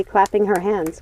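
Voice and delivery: plain reading voice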